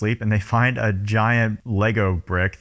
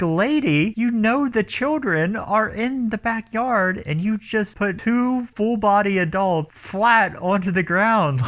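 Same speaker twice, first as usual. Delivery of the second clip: higher, slower disbelief at the mom; higher scolding of the careless movie mom; incredulous scolding; 10 semitones higher, slower than usual. Retold or imitated. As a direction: lecture her in higher exasperation.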